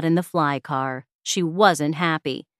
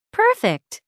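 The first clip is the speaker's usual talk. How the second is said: much higher, delighted squeal; 14 semitones higher than usual. Acted. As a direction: pop it up high with delight. Jenny pleased with bigger clothes.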